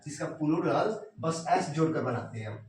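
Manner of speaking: ordinary delivery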